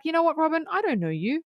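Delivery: high-pitched, playful brush-off; hammed-up casual rejection; mock dismissive. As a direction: disown them in a high, joking voice. Acting out Batman rejecting Robin.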